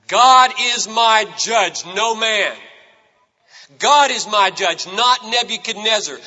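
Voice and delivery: rising preacher's declaration; preaching. Acted. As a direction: proclaim it to the congregation, building. Preaching climax, building declaration.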